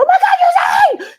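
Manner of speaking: very high fan scream; starstruck shout. Retold or imitated. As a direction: shriek it at your idol. Retells meeting her idol.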